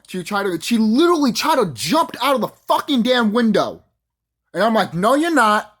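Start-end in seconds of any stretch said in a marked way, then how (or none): 0.6-3.8 emphatic amazement. Real, not acted. Excited climax of boast. 4.9-5.8 raised, louder retort; retold firm refusal. Storyteller stopping the executive from escaping, retold firm refusal.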